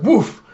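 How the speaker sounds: loud, high imitated woof; dog bark. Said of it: "he starts barking".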